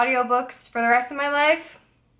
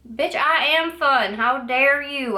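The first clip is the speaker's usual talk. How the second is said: mock indignation; 5 semitones higher, slower than usual. Indignant retort at the snubbers.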